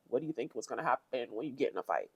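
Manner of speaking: low, soft, fast deadpan aside. Retold or imitated. Reacting to the fighter's complaint, low and deadpan. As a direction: mutter it under your breath, quick. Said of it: "I'm still sitting here like".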